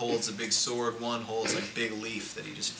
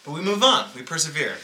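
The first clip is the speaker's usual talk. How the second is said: mock grand proclamation; 6 semitones higher, 8 dB louder than usual. Mock grand proclamation after a joke.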